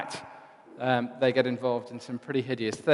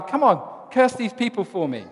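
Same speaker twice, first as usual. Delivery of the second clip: a hint of a pushy king; 7 semitones higher, 3 dB louder than usual. Balak nagging Balaam.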